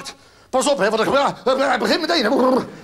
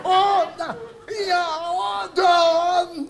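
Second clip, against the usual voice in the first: high, drawn-out cries of recognition; hammed-up realisation; 6 semitones higher, much slower than usual. Comic finally recognising the name, high and drawn out. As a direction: overplay the penny dropping. Sudden recognition, over the top.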